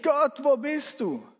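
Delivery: high, slow pleading cry; inward cry to God. Acted. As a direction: stretch out a desperate appeal. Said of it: "Du schreist zu Gott innerlich".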